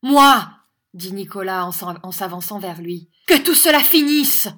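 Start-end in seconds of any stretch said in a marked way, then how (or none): 0.0-0.5 one high, booming word; defiant shout. A narrator's reading. Young teacher advancing on the schoolmaster, defiant shout. 3.3-4.5 high, loud order; commanding shout. A narrator's reading. Young teacher ordering the schoolmaster to stop, commanding shout.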